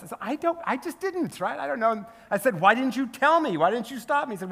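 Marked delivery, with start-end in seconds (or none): 0.2-2.0 high-pitched retold protest; mock-flustered excuse, pitched up; flustered defensive. [name] retells excusing himself to Eugene, flustered and defensive. 2.6-4.3 higher-pitched mock indignation; indignant complaint. [name] retells complaining to Eugene, indignant.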